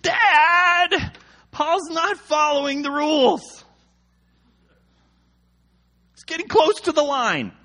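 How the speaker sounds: put-on high, loud whiny backseat child; tattling kid. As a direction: tattle to Dad in a high kid's wail. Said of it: "kids in the back seat".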